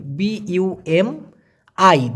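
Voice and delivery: slow letter-by-letter spelling. Shown as a demonstration. Teacher to viewers spelling Malay বুমি, slow letters. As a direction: spell out each letter slowly. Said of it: "উচ্চারণটা হবে".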